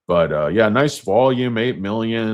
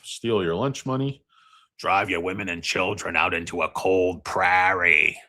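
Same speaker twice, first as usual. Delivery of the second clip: Mister Market voice; 5 semitones higher than usual. Pretend. Mister Market sneering at retail traders in a pompous voice. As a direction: lord it over the little retail guys.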